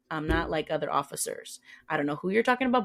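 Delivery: ordinary talk, nothing marked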